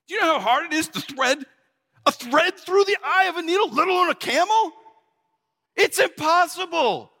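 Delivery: high-pitched, flabbergasted protest; stunned, squeaky-high exasperation; incredulous. Acted. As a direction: object in utter disbelief, pitch way up. Disciple's stunned reaction to saying.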